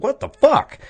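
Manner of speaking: high, loud mock disbelief; hammed-up squeal of disbelief; high shocked outburst. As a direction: shriek it in baffled surprise. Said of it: "you're gonna be like".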